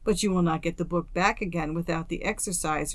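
ordinary delivery, nothing marked

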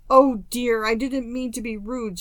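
a mild, understated sob; girl crying into her lap over her rudeness; sobbing. A narrator's reading. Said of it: "she sobbed".

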